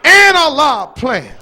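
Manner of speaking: And punched, slow and loud; stress on AND. Shown as a demonstration. Preacher repeating And for emphasis, slow and loud. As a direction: drive the And home slowly. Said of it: "The word and".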